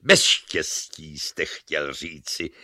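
slow, low, deliberate correction; pointed correction. A narrator's reading. Luck corrects Reason's saying, slow and pointed. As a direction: put them right, taking your time.

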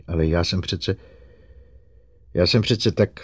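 halting, flustered protest; faltering words with a pause; stammered. A narrator's reading. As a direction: break off and restart, flustered. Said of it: "vykoktala ze sebe".

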